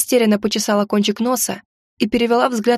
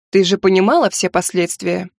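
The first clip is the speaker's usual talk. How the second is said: plain reading voice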